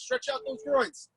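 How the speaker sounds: normal talking voice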